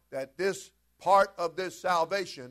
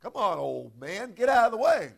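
mock preaching at slow driver; 4 semitones higher, 6 dB louder than usual. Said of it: "you start preaching to that".

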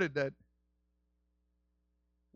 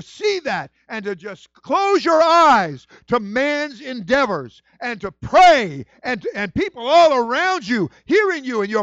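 preaching at full force; 7 semitones higher, 11 dB louder than usual. Real, not acted. Pastor preaching to his church family, building to a cry.

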